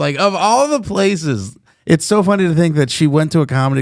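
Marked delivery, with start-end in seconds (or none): 0.2-1.6 high, drawn-out disbelief; retold incredulous groan of words, stretched out; mock dismay. Comic reacting to the neighbor in the club, mock dismay.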